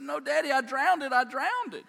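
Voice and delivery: high, loud mock wail; crying little boy. Little boy protesting to Daddy after going under, imitated crying. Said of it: "he starts crying immediately".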